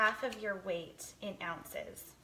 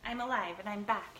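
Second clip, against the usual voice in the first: usual speaking voice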